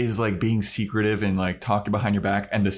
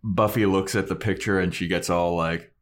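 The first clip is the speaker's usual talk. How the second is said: normal talking voice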